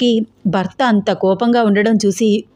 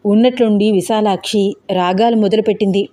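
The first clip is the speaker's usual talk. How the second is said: plain reading voice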